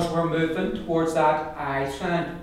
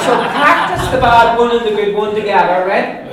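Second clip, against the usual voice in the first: higher, louder laughing instruction; 6 semitones higher, 12 dB louder than usual. Real, not acted. Teacher laughing while she tells the class to practise. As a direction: laugh through the tip.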